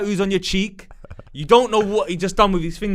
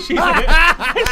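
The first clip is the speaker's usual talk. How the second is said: words swallowed by laughter, much louder; laughing; 12 semitones higher, 7 dB louder than usual. Real, not acted. Laughing mid-story.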